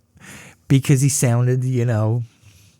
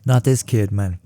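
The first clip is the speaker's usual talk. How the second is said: ordinary talk, nothing marked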